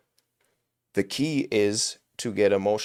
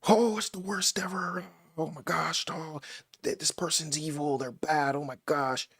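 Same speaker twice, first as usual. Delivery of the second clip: higher, breathy mock despair; mock heartbroken; 7 semitones higher than usual. Acting out someone cheated on, higher mock anguish. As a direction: ham up the betrayed lover lightly.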